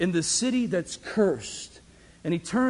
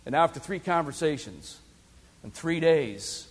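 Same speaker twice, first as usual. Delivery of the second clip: usual speaking voice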